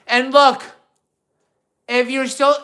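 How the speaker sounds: usual speaking voice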